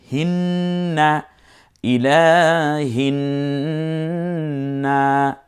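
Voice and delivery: ইলাহিন্না slowly chanted, n and vowels held; elongated recitation. Shown as a demonstration. Teacher building up the word, slow elongated ইলাহিন্না. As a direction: hum the n in হিন্না and draw out the vowels. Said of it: "এক আলিফ টেনে বললাম না".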